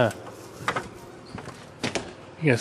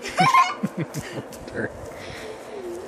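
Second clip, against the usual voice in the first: high laugh; 6 dB quieter than usual. Real, not acted. Child's funny kick.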